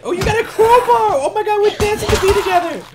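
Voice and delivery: very high, gleeful outburst; excited squeal. Pretend. Player thrilled by a crowbar and dancing, excited squeal.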